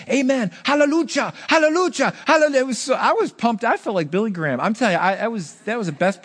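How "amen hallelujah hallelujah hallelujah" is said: excited hallelujahs. Retold or imitated. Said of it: "He was saying".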